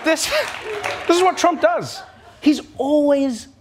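indignant outburst. Real, not acted. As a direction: throw your hands up at him. Host's outburst at Trump.

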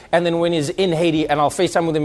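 ordinary talk, nothing marked

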